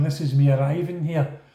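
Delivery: ordinary talk, nothing marked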